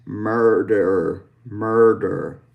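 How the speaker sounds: murder with held back er sounds; lazy pirate murder. Shown as a demonstration. Teacher finishing the lazy pirate drill, murder twice. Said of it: "lazy pirate one more time".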